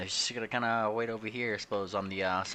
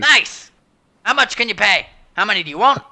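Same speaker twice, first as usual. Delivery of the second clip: higher and louder hawker voice; eager pirate dealer; 11 semitones higher, 13 dB louder than usual. Acted. Pirate merchant hawking goods, eager loud voice. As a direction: pitch it like a pushy salesman.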